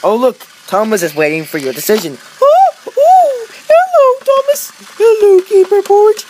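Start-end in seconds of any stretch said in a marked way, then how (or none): 2.4-6.2 very high put-on toy voice; Mister Lady voice. Mister Lady greets the viewers, very high. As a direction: squeak it like a tiny toy.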